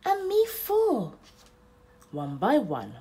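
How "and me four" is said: high, slow tiny-creature voice; squeaky little voice joining in. Acted. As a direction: stretch it out like a small eager thing. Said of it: "came another voice".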